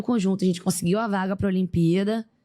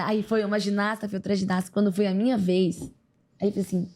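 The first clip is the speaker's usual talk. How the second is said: ordinary talk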